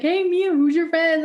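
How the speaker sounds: high, loud leering call; creepy guy voice. Retold or imitated. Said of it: "they're like".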